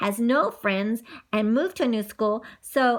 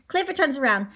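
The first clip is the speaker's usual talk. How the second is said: usual speaking voice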